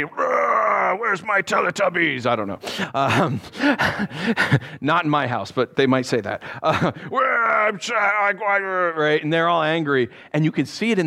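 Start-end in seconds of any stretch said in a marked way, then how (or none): 0.0-2.3 put-on angry kid. Angry kid voiced in a story. 7.1-8.6 put-on kid's shout. Angry kid voiced in a story.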